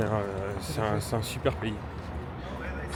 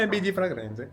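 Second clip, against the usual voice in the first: high, playful Italian lilt; Italian accent; 7 semitones higher than usual. Pretend. Vlogger signs off on the fair's name, playful Italian accent. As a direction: toss it off in hammed-up Italian. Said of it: "J'essaye de parler italien".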